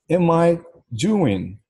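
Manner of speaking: "Am I doing?" slow, high "Am I", pitch swooping on "doing"; tom de pergunta. Shown as a demonstration. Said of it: "tem que falar com tom de pergunta". ("Am I doing?" em tom de pergunta, lento e mais agudo)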